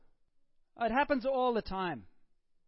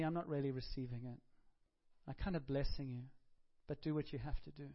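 soft, sorrowful and gentle, voice lowered; tearful tenderness; 4 semitones lower, 7 dB quieter than usual. Retold or imitated. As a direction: speak to the betrayer with quiet grief. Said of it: "I think there were tears in his eyes and said".

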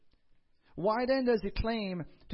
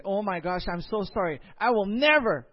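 loud, raised over-the-top apology building to NEVER; melodramatic, hammed-up promise; exaggerated vow; 4 semitones higher, 9 dB louder than usual. Mock over-apology, called exaggeration.

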